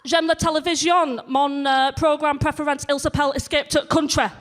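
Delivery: put-on swan in, French with a Leeds accent; broad northern French. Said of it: "she said".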